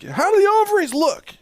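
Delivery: high, loud cheery mock doctor. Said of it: "So, like".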